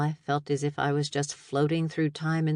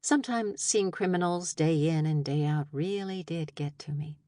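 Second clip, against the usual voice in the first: ordinary delivery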